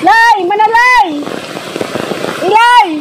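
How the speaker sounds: high, carrying call; calling the dog. Real, not acted. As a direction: call out across the field.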